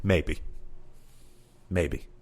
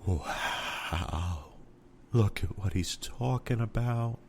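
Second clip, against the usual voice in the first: mock awed zombie crowd; 6 dB quieter, much slower than usual. Imitating dazed crowd, "just like.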